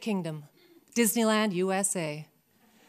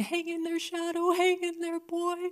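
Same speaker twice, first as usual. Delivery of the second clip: soft prayer; 8 semitones higher, 3 dB quieter than usual. Retold or imitated. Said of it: "I'm saying a prayer".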